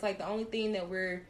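ordinary talk

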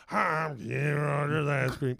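the singer, a bit bored and odd. Retold or imitated. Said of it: "And then I start going".

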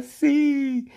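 long, high-pitched mock cry; acted yell. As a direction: shout back high and drawn out. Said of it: "Y Floyd grita". (grito fingido agudo y largo)

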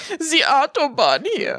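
slow imitation of a German accent; mock German accent. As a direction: mimic the German accent, drawn out. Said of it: "why is this German or something".

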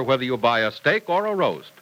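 ordinary talk, nothing marked

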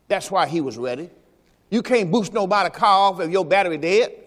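ordinary talk

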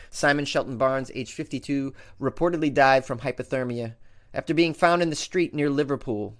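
ordinary delivery

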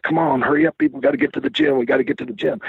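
high, urgent mock hurry-up; hurrying impatiently. Paul rushing his teammates to the gym, impatient. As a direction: rush them to the gym, impatient.

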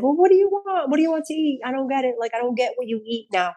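high-pitched, fussing mom impression; baffled mother. Mother questions vegan daughter about dinner, baffled. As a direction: imitate a mother who cannot understand, starting high. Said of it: "And my mom's like".

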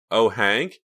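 high, loud sing-out of his name; calling out. A narrator's reading. Reva calls to Hank from her window, calling out. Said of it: "she called to him".

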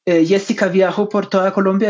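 normal talking voice

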